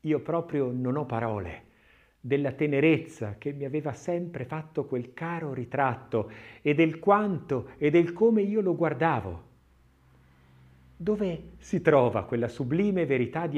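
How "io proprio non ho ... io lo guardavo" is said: ordinary delivery, nothing marked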